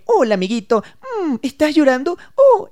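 put-on high cooing voice to a crying child; mock baby talk. Adult cooing at a crying child, high mock pity. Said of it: "cuando tú le vienes a un niño".

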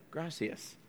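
Spanish with an American accent, said softly; soft gringo-accented Spanish thanks. Shown as a demonstration. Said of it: "that's my American accent".

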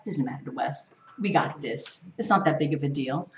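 mocking the church, soft and careless; dismissive shrug. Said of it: "They're like".

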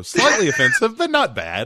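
laughing, high mock echo; high, loud start, amused. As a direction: repeat it like a slogan through laughter. Laughingly repeats friend's slogan.

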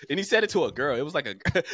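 ordinary delivery

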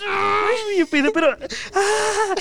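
a high wailing sob breaking out; crying burst. Retold or imitated. (sollozo agudo que se escapa)

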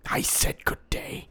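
loud, slow, emphatic send-off; mock outrage, each word drawn out; indignant dismissal. Indignantly dismissing the dog caller.

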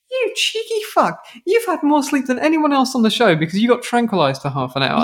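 high-pitched cheeky outrage; retold indignant telling-off; mock indignation. Host scolding the tranquilized man, mock indignant.

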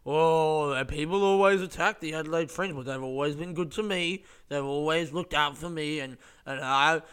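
mock the guy defending the festival, a little loud; defensive magician. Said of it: "he just went off on this".

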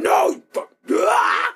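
strained unvoiced rage; mock furious gamer grunt; imitated raging gamer. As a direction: hiss it through a freak-out. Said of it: "grunting and freaking out".

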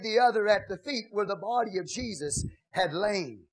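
ordinary delivery

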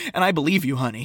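ordinary talk

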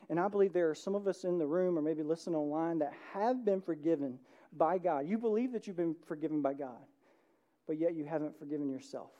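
normal talking voice

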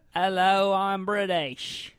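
loud, drawn-out terrible British accent; over-trying British accent. Shown as a demonstration. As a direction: try far too hard at sounding British. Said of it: "now you're over trying".

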